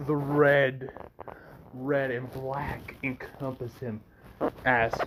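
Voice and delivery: usual speaking voice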